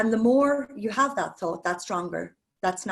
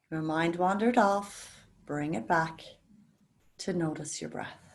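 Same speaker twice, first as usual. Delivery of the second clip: guided meditation. Acted. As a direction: ease the class into calm breathing, gently. Leads a guided breathing meditation.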